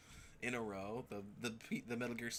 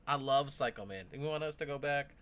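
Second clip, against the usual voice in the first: ordinary talk, nothing marked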